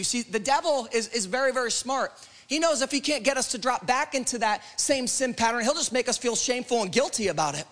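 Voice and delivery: urgent, driving sermon delivery; fervent preaching. Real, not acted. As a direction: press each promise home to the congregation. Preaches against the accuser.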